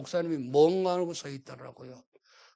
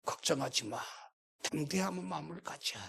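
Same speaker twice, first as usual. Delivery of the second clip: calm reassurance; 4 semitones lower, 7 dB quieter than usual. Retold or imitated. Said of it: "목사님이 아주 태연하시게".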